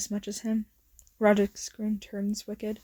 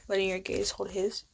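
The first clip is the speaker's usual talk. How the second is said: ordinary delivery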